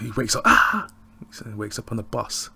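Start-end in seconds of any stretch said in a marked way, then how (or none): 0.4-0.9 sudden high loud waking scream; startled scream. Retold or imitated. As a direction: jolt awake with a yell. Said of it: "starts screaming".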